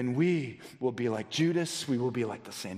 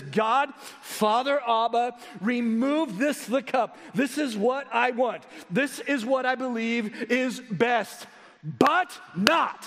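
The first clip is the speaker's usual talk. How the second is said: emphatic imperative plea, higher, building to a louder BUT NOT; raised voice; 10 semitones higher, 4 dB louder, slower than usual. Acted. Preacher voicing Jesus's prayer to the Father, raised voice. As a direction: raise your voice into a forceful, high-pitched appeal. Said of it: "I raised my voice and I clapped".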